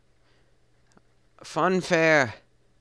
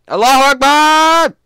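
very high, loud yell; battle cry; 14 dB louder than usual. Acted. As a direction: scream it while leaping. Cat leaps off shelf, shouting.

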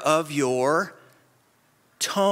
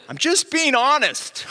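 high, louder "I'm just being honest"; a hammed-up indignant brother; mock defensive brother; 8 semitones higher, 4 dB louder than usual. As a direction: imitate the brother's defensive excuse. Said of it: "my brother will say to me".

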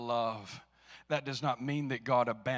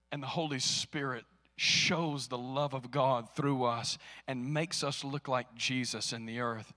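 ordinary delivery

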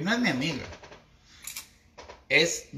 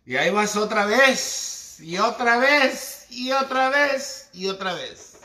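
rising higher, louder mock voice, then dropping off; mock tough woman; 7 semitones higher, 5 dB louder than usual. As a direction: ham up the woman who just shrugs and starts over. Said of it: "Y se reinventan y dicen". (voz burlona de mujer aguerrida, más aguda y fuerte)

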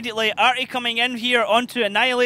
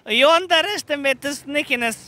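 teasing; 6 semitones higher, 4 dB louder, slower than usual. Acted. Caster teases the hidden player.

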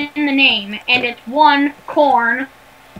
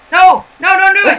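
loud; 5 semitones higher, 6 dB louder than usual. Real, not acted. Begging friend not to act.

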